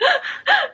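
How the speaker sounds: tearful gasp. Retold or imitated. Drunk crying, runs out door.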